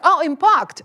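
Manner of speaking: Oh, impact with loud high surprise; mock student. Student mishearing impact, loud. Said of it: "and just like".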